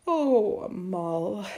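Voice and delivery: sighing, falling weary tone. A narrator's reading. Mother doubts her son's tale, weary. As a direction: sigh out the name wearily. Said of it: "she said wearily".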